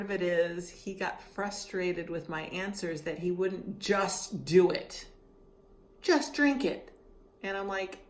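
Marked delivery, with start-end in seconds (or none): 6.0-6.9 higher, louder order; mock impatient doctor, raised; retold doctor order. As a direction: bark it out higher. Doctor voiced in story.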